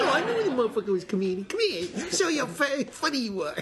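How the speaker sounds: 1920s gangster-era cop, mock; hammed-up old-time copper; old-timey cop voice. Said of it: "Old-timey cop voice".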